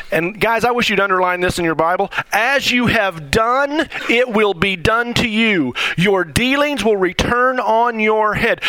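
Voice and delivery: usual speaking voice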